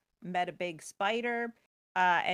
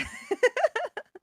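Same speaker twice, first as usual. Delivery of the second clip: high laugh; 7 dB louder than usual. Real, not acted. Laughing at hero for idiots.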